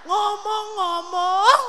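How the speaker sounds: high, crying plea; tearful wife. Acted. Wife crying over her husband's worn underwear, tearful. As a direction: sob out the words as a wife. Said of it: "Bini nangis".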